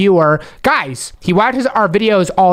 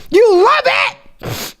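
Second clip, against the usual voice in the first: high, drawn-out delighted squeal; thrilled; 13 semitones higher, much slower than usual. Acted. Host learns the viewer loves the gift, thrilled. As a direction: squeal with slow, stretched delight.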